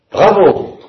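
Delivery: high, loud bravo; delighted cry. Acted. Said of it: "S'écria Ivan enchanté". (s'écrier bravo, enchanté)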